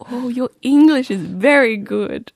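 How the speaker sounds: a hammed-up condescending well-wisher; mock patronizing compliment. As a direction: talk down to her like a kind stranger. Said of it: "someone will go".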